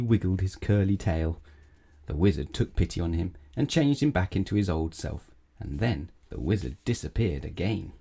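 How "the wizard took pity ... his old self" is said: ordinary delivery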